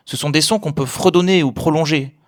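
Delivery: ordinary talk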